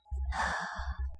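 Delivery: soft, breathy sigh; heavy sigh. Acted. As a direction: let out a quiet sigh mid-confession. Confessing a struggle to partner.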